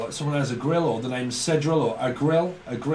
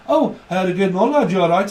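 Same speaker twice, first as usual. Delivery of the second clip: louder, higher friendly Birmingham voice; Brummie accent; 3 semitones higher, 5 dB louder than usual. Retold or imitated. Brummie greets a fellow lad, loud mock Brummie accent. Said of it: "That's how they speak up in Brummie land".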